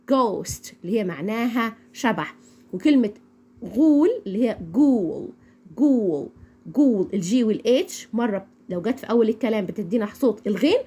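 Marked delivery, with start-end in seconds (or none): 4.7-7.1 "جول" with a hard g; repeated model word "جول", g first. Shown as a demonstration. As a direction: say "جول" like "gool". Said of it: "بتدينا حرف".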